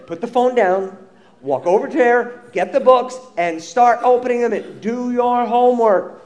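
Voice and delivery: ordinary talk, nothing marked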